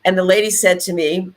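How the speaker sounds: normal talking voice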